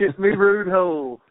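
high, loud, bad Australian attempt; botched Australian accent. Shown as a demonstration. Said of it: "they either sound British or like Borat".

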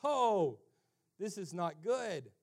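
lightly acted dismay; dismayed listeners. Crowd reacting to Jesus' impossible standard, dismayed. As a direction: voice the crowd's sinking realization. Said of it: "these people are thinking".

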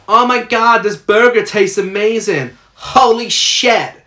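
high, overexcited fake American gushing over a burger; bad American accent. Sister mocking Amber's bad American accent over a burger. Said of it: "trying to do an American accent".

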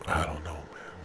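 ordinary delivery

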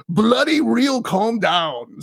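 lightly put-on English accent, dropping to finish; mock English dad telling off a child. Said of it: "that's in an English accent".

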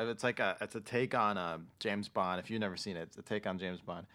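ordinary talk

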